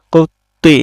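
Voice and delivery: কুত্তি with the t doubled and held; doubled consonant. Shown as a demonstration. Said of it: "সে হরফটি দুইবার করে উচ্চারণ হইতেছে". (তাজদিদে তা দুইবার উচ্চারণ)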